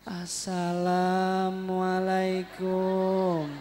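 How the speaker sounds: very slow, drawn-out pitiful greeting; pitiful salam. Shown as a demonstration. Preacher mimicking a pitiful person's greeting, very slow. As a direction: sound pitiful and worn out. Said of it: "salamnya kalau kita dengar kasihan, miris".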